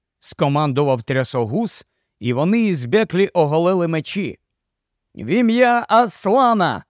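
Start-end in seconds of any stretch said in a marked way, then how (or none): 5.1-6.8 battle cry. A narrator's reading. Puddleglum and Eustace cut the ropes, a battle cry. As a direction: shout it as you draw your sword. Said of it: "крикнули вони".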